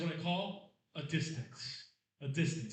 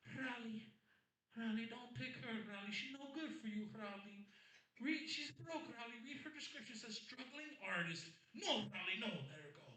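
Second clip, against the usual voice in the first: high, pleading mom voice hissing advice; mother's nagging whisper; 7 semitones higher, 10 dB quieter than usual. Retold or imitated. Comic telling his own story, playing his mother judging his dating app picks. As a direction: nag like a worried mother leaning over. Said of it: "She goes".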